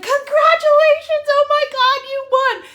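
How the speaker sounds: high, loud squeal; announcing the win, shrieking with joy; excited congratulations. Retold or imitated. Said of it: "I'm like".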